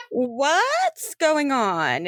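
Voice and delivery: slow, high, stretched-out question; awkward feigned casualness. Acted. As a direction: overdo the casual act, drawing the words out. Impostor feigning casualness to soldiers.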